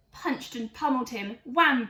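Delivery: ordinary delivery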